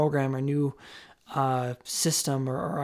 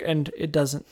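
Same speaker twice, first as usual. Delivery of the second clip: usual speaking voice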